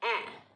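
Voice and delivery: ए said with the mouth opened a little; open a sound. Shown as a demonstration. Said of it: "थोड़ा सा मुंह खोल के". (मुंह थोड़ा खोलकर ए)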